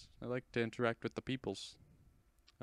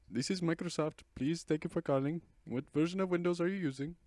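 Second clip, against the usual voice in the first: mock Indian accent, pitched up, playing Microsoft; light Indian accent, higher; 8 semitones higher, 4 dB louder than usual. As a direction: turn the tables in a put-on Indian accent. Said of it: "making an Indian accent".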